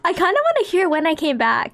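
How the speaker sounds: normal talking voice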